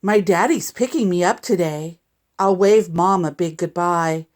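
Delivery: ordinary delivery, nothing marked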